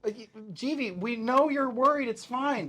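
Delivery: urgent reassurance. Acted. Companions calm a panicking friend.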